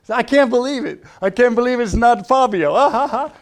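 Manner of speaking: high, loud mock gushing; mocking wife. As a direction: tease him in a shrill high gush. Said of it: "she's like".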